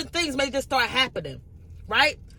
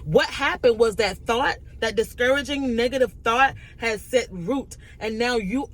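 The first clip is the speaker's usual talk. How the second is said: usual speaking voice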